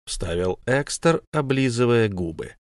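plain reading voice

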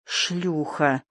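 low, quiet, spiteful mutter; angry mutter. A narrator's reading. Narrator voices a man insulting Жанна, angry low mutter. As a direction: mutter it softly with venom. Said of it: "пробормотал он злым голосом".

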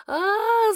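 high, loud, desperate scream; frantic scream. A narrator's reading. The heroine screams in anguish as dark magic rises. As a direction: let out a wild, anguished cry. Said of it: "закричала я истошно".

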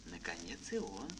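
ordinary delivery

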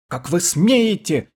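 high shrill shriek of outrage. A narrator's reading. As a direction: screech it in outrage. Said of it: "визжал Эрл".

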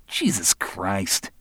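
slow, drawn-out weary oath; bored. Acted. Barry brushes off Roland's challenge, bored drawl. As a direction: drag it out, totally unimpressed. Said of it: "sounding bored".

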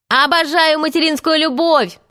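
high-pitched, gushing exclamation; enthusiastic yell. A narrator's reading. Nibs declares he adores mother love, yelling high. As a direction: yell it high, without much extra volume. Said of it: "завопил Кончик".

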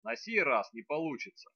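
ordinary delivery

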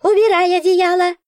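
high, loud shouted order; commanding creature. A narrator's reading. Nora ordering the blanket off, loud high. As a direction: bark the command.

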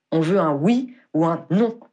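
slow, firm put-down; impatient officer cutting off a condescending witness; curt rebuff. A narrator's reading. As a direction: shut her down, word by word. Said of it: "la rembarra Dagworth".

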